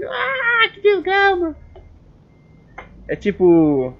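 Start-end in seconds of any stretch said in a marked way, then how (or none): 0.1-1.5 very high, whiny fake cry; mock wail. Vlogger envying [name] accents, mock crying wail. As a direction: wail it in hammed-up frustration.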